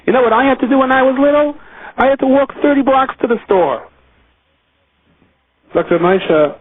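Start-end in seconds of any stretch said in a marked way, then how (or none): 0.0-3.8 high, fast, put-upon mother's nagging; guilt-trip the child with a high whine; complaining mother. Retold or imitated. A mother nags her child to go to the store: high mock complaint. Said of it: "Or a mother says to a child".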